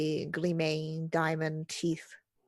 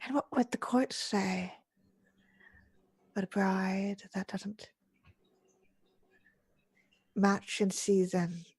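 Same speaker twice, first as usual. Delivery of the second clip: disused whispery voice; 2 semitones higher than usual. Acted. Veiled woman warning the bride about the court, whispery. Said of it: "whispers in a voice that feels like it had not been used".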